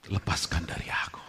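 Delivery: soft, low whispered plea; whispered prayer. Acted. Believer asking the Holy Spirit for release, whispered. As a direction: breathe the plea to God.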